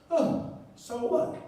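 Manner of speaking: drawn-out scoffing "so what"; mock dismissive. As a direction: shrug it off with scorn. Dismissing endless cases.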